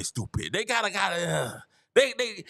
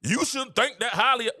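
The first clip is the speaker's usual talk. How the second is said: ordinary talk, nothing marked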